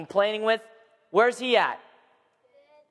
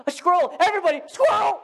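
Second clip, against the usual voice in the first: high, gasping excited squirrel alert; mock excitement; 10 semitones higher than usual. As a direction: gasp and shout about the squirrel.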